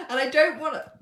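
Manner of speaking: talking through a burp; words caught on a burp. Real, not acted. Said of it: "excuse me, I'm burping".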